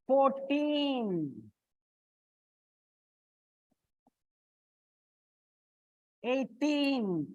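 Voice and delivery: put-on fourteen, eighteen with a long, stressed TEEN; four-TEEN, eigh-TEEN, each said on its own. Shown as a demonstration. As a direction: draw out the second syllable of fourteen and eighteen. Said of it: "it's on the teen".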